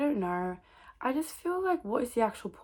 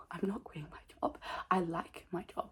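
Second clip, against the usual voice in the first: hushed; 2 semitones lower, 9 dB quieter than usual. Real, not acted. Quiet self-talk.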